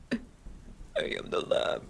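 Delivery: slow robot voice, mostly without pitch; [name]. Acted. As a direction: imitate a creepy robot text-to-speech voice. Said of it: "I want just [name] to come out here and just".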